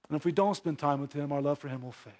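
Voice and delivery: ordinary delivery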